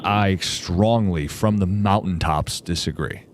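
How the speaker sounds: slow emphatic. Real, not acted. Emphatic disagreement with caller.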